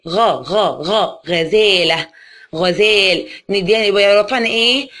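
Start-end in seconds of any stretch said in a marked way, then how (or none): 0.1-3.3 slow, stretched syllables for the class; teacher drawing the word out slowly; drawn-out sounding. Shown as a demonstration. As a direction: sound out the first letter, then stretch the word. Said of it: "يعني زي كلمة ايه؟".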